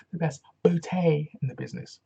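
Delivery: hammed-up colleague catchphrase; quoting English colleague. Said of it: "As my English colleague would say".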